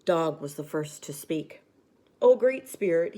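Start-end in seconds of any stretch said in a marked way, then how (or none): 2.2-3.2 high, loud fearful howl. A narrator's reading. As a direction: wail it up to the spirit. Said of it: "he howled".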